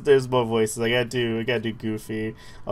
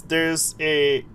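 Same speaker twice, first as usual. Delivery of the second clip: ordinary talk, nothing marked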